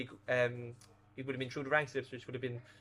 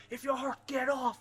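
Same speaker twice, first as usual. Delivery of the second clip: high, slow mock shout from the stands; retold manager's shout; 11 semitones higher, slower than usual. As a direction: bark it like the gaffer from the stands, pitched high and slow. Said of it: "Keane shouting in the stands going".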